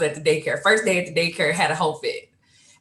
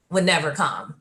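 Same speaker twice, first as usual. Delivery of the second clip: ordinary delivery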